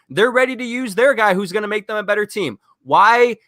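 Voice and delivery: heated, high-pitched venting; exasperated rant. Real, not acted. Fan demanding why we have to see him, exasperated.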